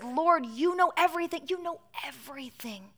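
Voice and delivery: rising then sinking to a pained hush; wounded, softening to a breath; hurt plea. A narrator's reading. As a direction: answer hurt, fading into a near whisper. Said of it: "Peter was hurt".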